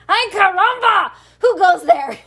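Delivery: high, loud startled cry; loud challenge. Acted. Kitten challenging a band of Chihuahuas, loud. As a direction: call out boldly into the dust. Kitten meets desert bandits.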